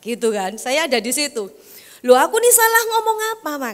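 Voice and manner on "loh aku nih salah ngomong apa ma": pitched up, plaintive protest; the son asking what he said wrong; high whiny protest. Retold or imitated. Son protesting to mother, higher, plaintive.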